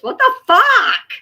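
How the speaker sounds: high, slow, loud disbelief; incredulous shriek. Real, not acted. Incredulous at comparison.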